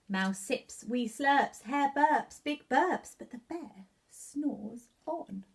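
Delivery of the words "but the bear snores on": sleepy refrain. A narrator's reading.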